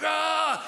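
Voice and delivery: high, drawn-out mock cry of frustration; frustrated cry. Customer told the shop has none, high frustrated cry. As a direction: wail in mock despair.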